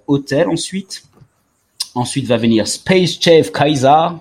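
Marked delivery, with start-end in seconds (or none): 2.7-4.1 slow, showy accent on the English title; super foreign accent. Shown as a demonstration. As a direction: lay a put-on foreign accent on it, slowly. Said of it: "avec mon super accent".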